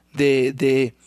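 ordinary delivery

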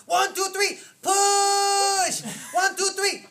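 high, full-volume drawn-out PUSH; shouted birth coaching. Retold or imitated. Girl coaching Stephanie through birth in Hong Kong, imitated shouting. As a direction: count and holler like a delivery coach. Retold delivery-room coaches yelling.

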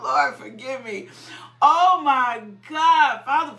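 ordinary delivery

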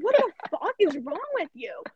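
high-pitched, indignant demand; retold inner outburst. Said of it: "In my head, I was saying it out loud".

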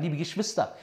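ordinary talk

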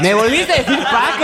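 mock outrage. Mock outrage at being called Paco.

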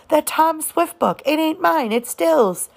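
put-on a little girl fretting over a borrowed book; young girl Scout. A narrator's reading. Scout voiced in story.

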